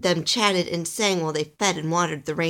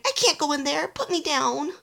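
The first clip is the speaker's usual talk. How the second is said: high, pleading protest; panicked cry; 9 semitones higher than usual. A narrator's reading. Alistair hoisted toward the scary house, pleading panic. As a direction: beg in a high small-elf voice. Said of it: "Alistair cried".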